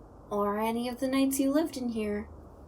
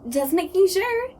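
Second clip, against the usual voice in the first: gleeful; 5 semitones higher, 7 dB louder than usual. Acted. Ghost keeps tickling the awake human.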